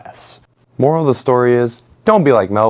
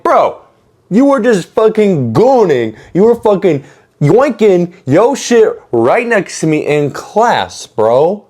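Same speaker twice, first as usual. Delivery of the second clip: retold call-out; 8 semitones higher, 4 dB louder than usual. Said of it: "and I'm like".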